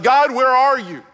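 high, loud plea to God; a loud, pleading cry of doubt; desperate cry. Acted. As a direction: call out to God in anguish.

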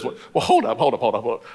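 lightly voiced hesitant Gideon pumping the brakes; Gideon balking. Gideon to the angel, lightly acted hold up.

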